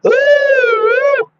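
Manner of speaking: high wailing siren imitation; long, high "ooh" of a siren. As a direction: wail like an ambulance siren, high and sustained. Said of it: "They say siren".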